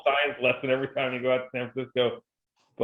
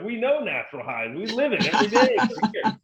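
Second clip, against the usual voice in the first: higher, laughing smug locals; smug San Diegans; 9 semitones higher than usual. Retold or imitated. Teasing San Diego audience about their natural highs, smug and laughing. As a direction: brag it like a contented beach local. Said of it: "I was kidding with them".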